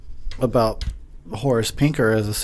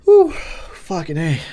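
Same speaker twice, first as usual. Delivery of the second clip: relieved; 5 semitones higher, 5 dB louder, much slower than usual. Real, not acted. Relieved exhale.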